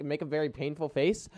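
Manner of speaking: ordinary talk, nothing marked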